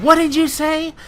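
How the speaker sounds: high, loud, affronted question; mock outraged disbelief. Substitute challenging cheeky student, high outraged disbelief. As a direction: leap high and loud, as if scandalized.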